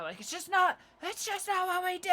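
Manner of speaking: mocking other teachers. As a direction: pitch it way up to mock the stuffy teacher. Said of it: "other teachers were like".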